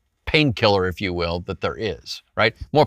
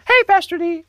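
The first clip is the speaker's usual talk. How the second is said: much higher squeaky kid voice imitating a young boy; boy's unbroken voice; 3 dB louder than usual. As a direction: chirp it like a kid before his voice broke. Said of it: "so he talked like this".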